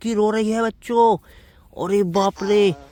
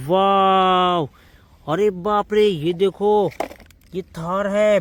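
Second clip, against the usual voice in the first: ordinary talk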